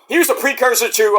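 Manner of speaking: ordinary talk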